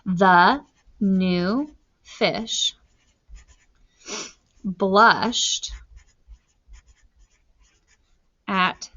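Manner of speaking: slow, word-by-word dictation. Shown as a demonstration. Teacher dictating to class while writing, slow and spaced. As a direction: spell it out slowly as you write. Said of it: "The capital T for the".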